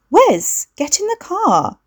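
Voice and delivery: high, bright, bubbly sister calling out; a cultured voice with a hint of Essex. A narrator's reading. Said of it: "His sister's voice was artificially cultured".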